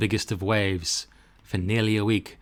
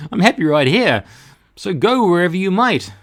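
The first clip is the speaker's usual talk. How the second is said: raised, cheerful cork; happy cork; 8 semitones higher, 8 dB louder than usual. Acted. Cork tells the pelican it is happy, raised and cheerful. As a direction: call out contentedly.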